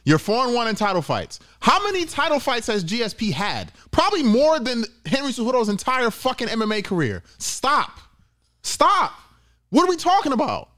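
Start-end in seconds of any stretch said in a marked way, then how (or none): 1.6-10.7 high, heated, exasperated yelling; angry rant. Real, not acted. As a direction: let it rip in disbelief. Heated rant over GSP title fights.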